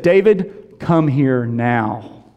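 low, slow, stern command; stern teacher. Retold or imitated. Wife orders defiant kindergartner to the door, slow stern command. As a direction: call the boy in with slow, firm authority. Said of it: "very sternly".

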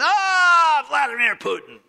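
high, loud shouted oath; mock angry curse. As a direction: bellow the name in mock rage. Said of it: "no one gets mad and says".

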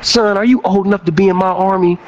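high, scolding drill sergeant. Retold or imitated. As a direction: chew out the recruit. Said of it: "my drill sergeant was like".

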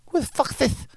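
high-pitched impression of an angry boxer; Mike Tyson. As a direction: put on a small, high voice, affronted. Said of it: "he looks at me like".

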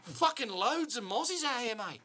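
high, hammed-up Aussie bloke; mock Australian accent. Cohost riffing on Aussie slang, hammed-up Aussie accent. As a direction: play a cartoon Aussie moaning about mozzies.

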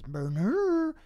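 high, loud monster gibberish; Grimace noises. Acted. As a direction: draw it out as a loud creature cry. Voicing Grimace's nonsense speech.

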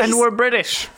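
slow, high put-on British accent; mock British accent, pleading. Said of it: "Is that your British accent?".

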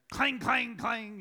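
loud mock clanging, rising high; playful loud clang imitation; imitated clang. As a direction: bang out the clang like metal on wood. Imitating spoon clanging on floor.

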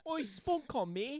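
high mock Australian accent, half laughing; a sloppy Australian impression; bad Australian accent. Teasing his friend about Aussie slang, in a mock Australian accent. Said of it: "not even a fucking Australian accent".

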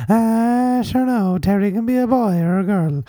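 high, lilting Irish mammy; Aunt Terry. Pretend. Mother soothing son Terrence, high lilting auntie voice. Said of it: "that's, um, that's Anne Terry's son".

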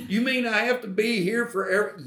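imitating someone questioning the demand; retold incredulous question. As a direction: echo it back in high-pitched disbelief. Acting out the questioning reply.

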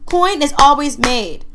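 slowed-down emphatic declaration; emotional. Real, not acted. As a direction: preach it like a conviction, real feeling. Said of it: "Sorry, I got emotional".

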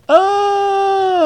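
very high drawn-out scream; hammed-up wail of burning pain; mock agonized scream. Vampire splashed in the face with holy water, mock scream. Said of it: "splashed it up on his face".

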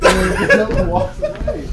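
laughing. Real, not acted. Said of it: "almost killed me".

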